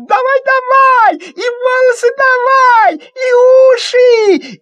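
very high, loud, gleeful urging; girl hurrying him. Acted. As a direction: rush him like an excited child. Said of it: "Алёнка торопит".

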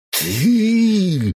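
high enraged cry; furious shout. Acted. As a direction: scream the word out. Angry man leaps up.

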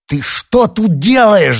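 high, loud, drawn-out yelp; indignant high-pitched shout; outraged yell. A narrator's reading. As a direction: yell at an intruder in disbelief. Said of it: "завопил Никита".

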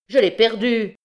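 high, loud cry of loss; a sudden loud cry of dismay. A narrator's reading. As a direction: blurt it out in panic. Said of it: "s'écria-t-il".

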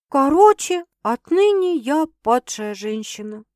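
mildly sour, resigned announcement; sour grumble. A narrator's reading. Girl confessing to her sister, sour. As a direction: grumble the verdict on yourself. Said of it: "кисло буркнула она".

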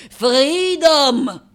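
loud, high, drawn-out proclamation; ringing shout of liberty; exultant cry. Acted. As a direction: cry it out like a slogan. Artist proclaims her freedom.

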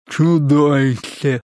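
slow, gurgly monster growl; gurgling monster. Acted. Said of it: "пробулькал он". (булькающее чудовище)

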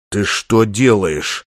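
low, slow, menacing question; hissed reproach. A narrator's reading. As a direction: hiss it down the phone, slowly. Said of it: "зашипел в трубке Чесноков".

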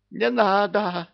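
very high, loud hysterical cry; hysterical plea. A narrator's reading. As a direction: beg in panic. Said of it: "забился в истерике Иблис". (истерический крик)

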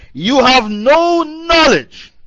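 high, drawn-out declaration; emphatic rebuke. Retold or imitated. Angels telling him what he never knew, emphatic rebuke. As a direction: proclaim it high and very slowly.

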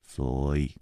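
low, slow, steady hero's voice; calm older hero. Acted. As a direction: speak low and unhurried, gently reproaching. Chu Liuxiang presses.

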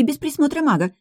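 put-on high, breathless young maid. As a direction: rush it out like a flustered maid. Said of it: "запыхавшаяся Лара".

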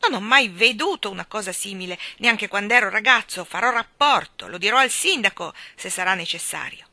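loud, scandalized official; indignant ranting. A narrator's reading. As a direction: threaten to report it, loudly. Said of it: "gridò con il pugno chiuso".

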